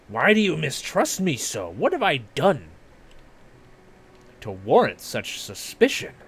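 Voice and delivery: wounded game-character voice, high at first; affronted lighthouse keeper. Acted. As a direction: protest the mistrust in character.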